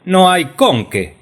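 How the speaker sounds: "con qué" dicho CÓN-que, agudo; acento en CON. Acted. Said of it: "pongan el acento en la O". ("con qué" con la O tónica y alta)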